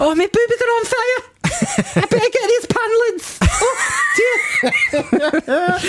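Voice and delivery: squealing falsetto Scottish nanny; hammed-up, hysterical high impression; Mrs Doubtfire. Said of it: "Robin Williams in Missus Doubtfire".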